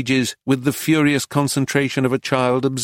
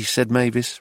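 usual speaking voice

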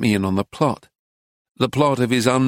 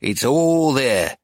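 slow, thin, petulant; slower than usual. A narrator's reading. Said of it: "in his thin, petulant voice".

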